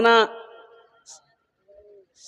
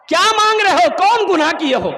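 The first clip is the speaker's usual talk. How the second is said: urgent questioning; 8 semitones higher, 4 dB louder than usual. Acted. Holy man questions crying youth.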